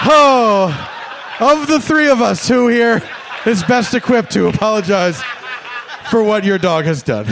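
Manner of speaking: loud high mock-innocent asking; sarcastic pointed question. Comic asking the silent dog owner who should apologize, loud sarcastic mock innocence.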